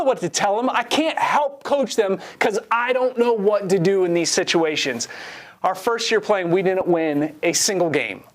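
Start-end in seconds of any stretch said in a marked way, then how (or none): none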